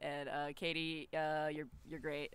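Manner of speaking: imitating Jordan. Katie mocking her cohost Jordan in a low voice. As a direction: play Jordan, deep and dopey. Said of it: "made my voice go really deep".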